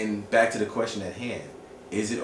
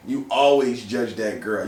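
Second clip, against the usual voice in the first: normal talking voice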